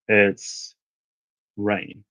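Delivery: ordinary talk, nothing marked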